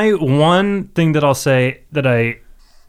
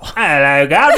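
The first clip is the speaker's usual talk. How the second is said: mock Cockney accent; 4 dB louder than usual. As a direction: parody a Cockney hello. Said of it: "reading it with a Cockney accent".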